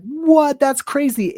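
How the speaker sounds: squeaky high what; surprised. Retold or imitated. Reliving finding her video, surprised and high. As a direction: gasp it out in disbelief. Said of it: "And I was like".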